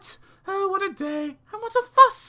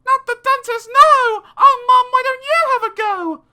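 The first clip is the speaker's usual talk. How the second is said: high, loud pleading yell; child shouting no to the dentist; 11 dB louder than usual. Acted. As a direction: beg Mum, near panic. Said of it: "I shouted".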